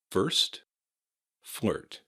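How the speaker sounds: "First, flirt" with each final T clearly released; careful model words "First, flirt", no dropped T; final T held on. Shown as a demonstration. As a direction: hang on to the T ending "First" and "flirt". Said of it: "You want to hang on to those".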